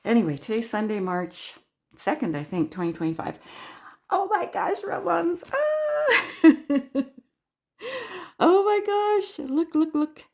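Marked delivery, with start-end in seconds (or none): 4.1-7.0 high gleeful exclamation, rising to a squeal; giddy high-pitched delight; thrilled squeal. Real, not acted. Looking at the gift. 8.4-10.2 high-pitched eager delight; excited amazement. Real, not acted. Showing off the gift.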